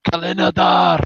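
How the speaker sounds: battle cry. Acted. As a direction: call out a war cry while charging. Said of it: "runs out and shouts".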